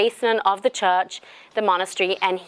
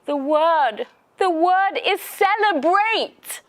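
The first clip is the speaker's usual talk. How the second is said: wailing punchline; 8 semitones higher, 3 dB louder, slower than usual. Retold or imitated. Crying monk delivers punchline.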